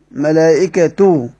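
ملائكة slowly, ending in a long clear "-tu"; damma reading. Shown as a demonstration. Teacher repeats the variant for students, ملائكة slowly with -tu. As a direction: stretch the final u of ملائكة. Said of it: "طب من قرأ بالضم؟".

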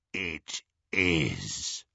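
deep, hushed villain rasp; low rasp. A narrator's reading. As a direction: rasp it out in slow realization. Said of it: "rasped Greyback".

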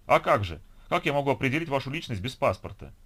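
indignant clerk's rhetorical question; exasperated official. A narrator's reading. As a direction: scold as if it were obvious. Registrar lectures visitor without passport.

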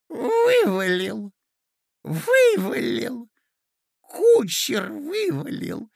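put-on high, loud, excited old prince; animated. A narrator's reading. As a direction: burst out, thrilled and quavering. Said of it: "с необыкновенным одушевлением".

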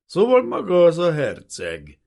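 loud, slow, high accusation; drawn-out hostile challenge; hateful sneer. A narrator's reading. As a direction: drag it out with open contempt. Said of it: "Gyűlölet volt az arcán".

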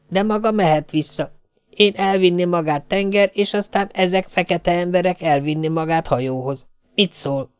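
high, earnest broken-speech voice of the Swede; a simple helper laying out the plan; foreign cook. Acted. Swedish cook explaining to Jane he cannot go back, earnest.

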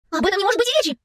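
high, emphatic refusal; objecting. A narrator's reading. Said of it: "возразила Билли".

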